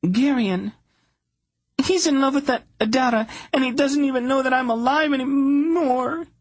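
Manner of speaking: very high, fast and tearful. A narrator's reading. Princess sobbing to Polgara about Adara, tearful. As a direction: wail it through sobs.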